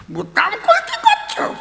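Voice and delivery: high, far mock wife voice; mimicking the angry wife. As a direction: squeak it like the wife mocking. Wife's mocking voice. (বউয়ের নকল করে সরু উঁচু গলায়)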